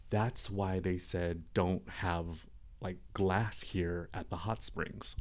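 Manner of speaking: soft, slow, far-away words; dazed murmur. Retold or imitated. Pulled out of the vision by the knife, dazed and soft. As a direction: speak as if half out of a trance.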